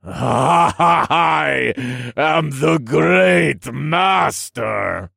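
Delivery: high, loud, crazed proclamation; maniacal villain shout. A narrator's reading. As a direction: roar it slowly through mad laughter. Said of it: "laugh continued to grow louder and louder".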